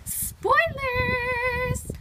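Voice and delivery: high, teasing mock warning; high playful spoilers cry. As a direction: sing it out like a coy catchphrase. Teasing catchphrase after excited reveal.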